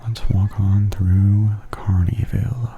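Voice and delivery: normal talking voice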